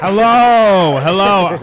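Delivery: big booming hello; loud greeting. Real, not acted. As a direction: greet the whole room loudly. Comic greets crowd.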